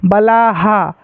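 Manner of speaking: "बलाहा" ending in a clear haa; visarga echoing vowel. Shown as a demonstration. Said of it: "यानी हा हो जाएगा". ("बलाहा" में विसर्ग को हा बोलें)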